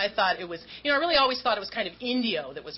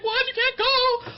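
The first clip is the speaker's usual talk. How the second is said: high, loud, alarmed mother's voice; shrill it out like a shocked mom; mom protesting; 6 dB louder, slower than usual. Retold or imitated. Mother refusing to let her daughter leave, high and loud.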